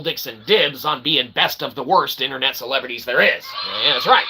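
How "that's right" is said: normal talking voice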